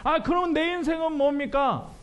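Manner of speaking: high, whiny mock protest at God; complaining believer. Preacher mimics a grumbling believer, high whiny protest. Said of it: "많은 사람들이 뭐".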